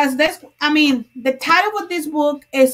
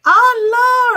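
startled cry; 9 semitones higher, 5 dB louder than usual. Real, not acted. Startled by bingo claim.

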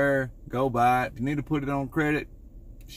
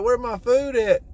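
higher, louder wife demanding; 9 semitones higher, 3 dB louder than usual. Retold or imitated. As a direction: play the wife asking where her food went. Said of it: "she be like".

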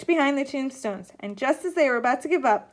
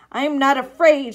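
loud, defiant declaration; bold declaration; 2 semitones higher, 9 dB louder than usual. A narrator's reading. Sloane defying the ghost before the crowd, bold and loud. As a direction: declare it firmly and loudly. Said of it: "she declared with her hands".

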